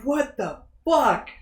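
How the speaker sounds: slow, high and soft; baffled disbelief. Retold or imitated. Podcaster retelling reaction to the eye in coffee, hushed bafflement. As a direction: breathe it out slowly, stunned.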